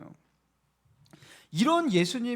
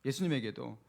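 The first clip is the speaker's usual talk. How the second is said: 예수님에게도 repeated much lower and softer; soft echo; 7 semitones lower, 7 dB quieter than usual. Shown as a demonstration. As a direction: let 예수님에게도 fall low and quiet. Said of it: "예수님에게도 서운한 거예요". (예수님에게도를 낮고 조용하게)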